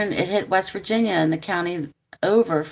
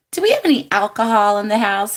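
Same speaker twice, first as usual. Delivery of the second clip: louder, fast eager asking; eager question; 3 semitones higher, 6 dB louder, faster than usual. Retold or imitated. Niece asks her aunt for drinks, fast and eager. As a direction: blurt it out hopefully.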